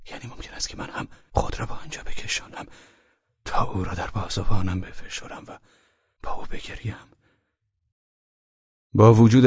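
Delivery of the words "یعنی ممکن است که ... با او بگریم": whispered. Acted. Inner shameful thought continues.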